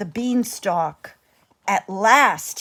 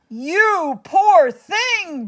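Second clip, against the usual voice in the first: higher, slow, a little louder giantess; a kind giantess booms down at Jack; booming giantess; 8 semitones higher, 4 dB louder, slower than usual. Acted. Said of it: "boomed the giantess".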